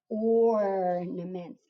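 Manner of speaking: ornaments stretched out very slowly, sound by sound. Shown as a demonstration. Teacher sounding out a tricky word for readers, very slow. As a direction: drag ornaments out as if sounding each letter. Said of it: "we're gonna sound it out".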